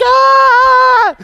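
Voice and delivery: high, loud squealing delight; excited shrieks. Retold or imitated. Young women scream over a friend's pregnancy news, squealing with delight. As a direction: squeal like you just heard the best news. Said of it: "holding each other's hands like".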